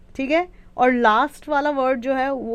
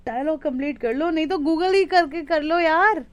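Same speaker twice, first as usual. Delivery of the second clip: ordinary talk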